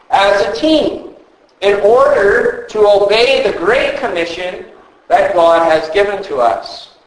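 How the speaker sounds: normal talking voice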